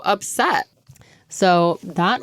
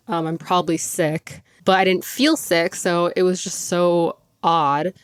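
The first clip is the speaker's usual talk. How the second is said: ordinary talk, nothing marked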